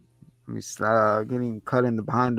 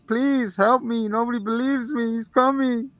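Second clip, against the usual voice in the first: pleading; 10 semitones higher than usual. Retold or imitated. Locked up, crying, begging.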